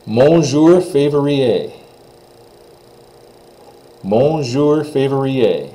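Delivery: Mon jour février very slow, stress on jour; slow teacher demo; French pronunciation. Shown as a demonstration. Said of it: "Letter Q".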